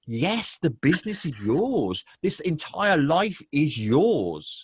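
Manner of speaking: retold big triumphant yes; emphatic reassurance. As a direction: proclaim it like a coach setting them free. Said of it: "And I'm like".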